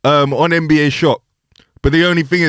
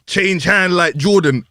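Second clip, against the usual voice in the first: normal talking voice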